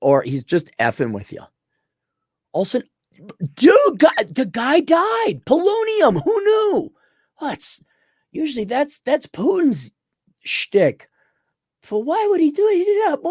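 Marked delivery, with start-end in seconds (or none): 2.5-13.3 high, sing-song feigned innocence, much higher; mock-innocent Polish guy. Polish agent feigning innocence to viewers, high mock voice. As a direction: play dumb about the dead dictator in a high voice.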